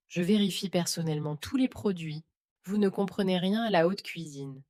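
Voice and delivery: ordinary delivery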